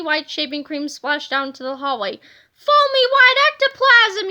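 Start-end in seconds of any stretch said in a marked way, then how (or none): 2.5-4.3 very high, loud shriek of panic; screaming yell. A narrator's reading. Cheerleaders shriek at the foam flooding the hallway, panicked scream. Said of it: "yelled the cheerleaders".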